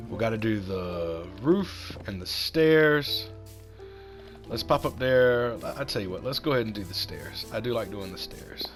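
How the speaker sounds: ordinary delivery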